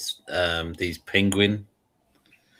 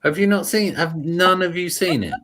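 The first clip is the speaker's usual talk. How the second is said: higher, louder disbelief; incredulous; 10 semitones higher, 5 dB louder than usual. Real, not acted. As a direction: ask in real astonished disbelief. Disbelief that nobody has seen it.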